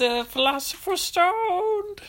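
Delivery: high, slow, drawn-out show title; show announcer. Acted. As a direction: announce it like a big intro. Host announces show name.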